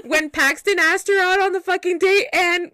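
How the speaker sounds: high, loud, outraged through laughter; laughing outrage. Real, not acted. Woman retelling her date to a friend, loud indignant laughing rant. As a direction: blurt it out in disbelief.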